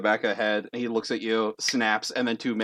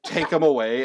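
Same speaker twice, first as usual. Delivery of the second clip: ordinary talk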